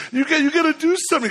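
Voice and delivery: high, fast frantic urging; begging the pastor to act, frantic and fast; frantic plea. Retold or imitated. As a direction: push him, panicked and rushed. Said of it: "I'm like".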